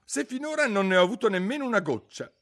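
high, loud and fast retort; Alice stung, replying to the March Hare; piqued. A narrator's reading. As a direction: snap back, offended. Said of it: "rispose Alice piccata".

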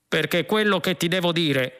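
shout. A narrator's reading. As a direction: call it out firmly as the cop. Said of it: "gridò il poliziotto".

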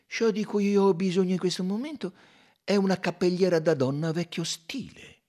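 ordinary delivery, nothing marked

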